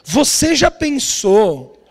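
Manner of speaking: slow, drawn-out enticing question; suspenseful. Retold or imitated. Dad tempts daughter with Minnie house, suspenseful. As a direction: build wonder word by word, slowly.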